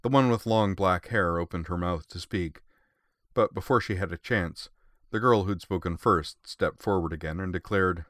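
plain reading voice

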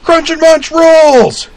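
very high, slow yell; yelling vendor. A narrator's reading. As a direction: hawk it at full volume. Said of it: "and yelled again".

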